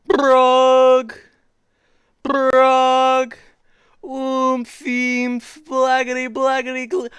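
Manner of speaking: high, held nonsense wail; loud, stretched-out gibberish on one high note; silly sounds. Acted. Reader forced to make silly sounds, high sustained nonsense. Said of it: "And make silly sounds like".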